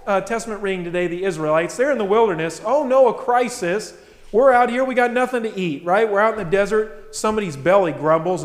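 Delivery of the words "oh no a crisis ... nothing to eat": mock panic. Mimics panicking Israelites in the wilderness.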